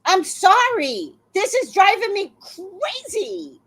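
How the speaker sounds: ordinary talk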